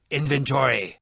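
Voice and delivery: ordinary talk, nothing marked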